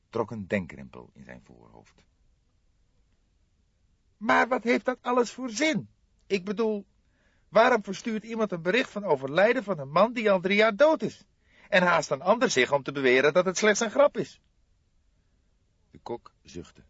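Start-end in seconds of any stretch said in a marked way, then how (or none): 4.2-14.4 put-on puzzled young man, higher; a baffled young colleague asking, higher; young detective voice. Fledder questioning De Kok, higher puzzled voice.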